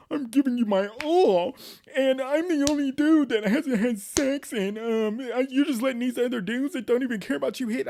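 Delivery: high-pitched, hammed-up childish complaint; mock him as a kid throwing a tantrum; whiny kid imitation. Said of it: "starts acting like a fucking kid".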